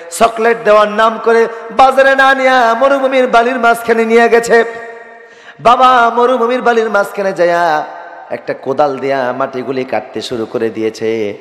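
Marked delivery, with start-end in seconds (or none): 0.1-7.9 high, ringing sermon delivery; impassioned preaching. Acted. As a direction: lift the story into a high preacher's chant. (আবেগভরা উঁচু গলায় ওয়াজ)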